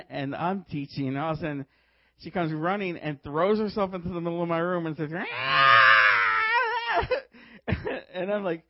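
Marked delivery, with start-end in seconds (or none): 5.1-7.1 long, loud mock scream; drawn-out, full-force imitation shriek; imitated demonic scream. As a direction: let out a possessed girl's wail. Imitates possessed girl screaming.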